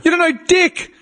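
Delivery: high-pitched, acted indignation; mock scolding. Adult to a bossy five-year-old, mock scolding.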